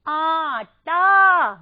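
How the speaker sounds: आटा very slow and loud, both vowels held; long आ sounds. Shown as a demonstration. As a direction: split आटा into two long, ringing syllables. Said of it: "मेरे साथ साथ बोलेंगे आप".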